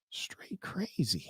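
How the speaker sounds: whispered aside. Real, not acted. Streamer whispering an aside to chat about the guy. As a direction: breathe it out like a secret.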